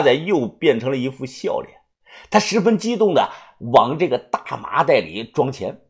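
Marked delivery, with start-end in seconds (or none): none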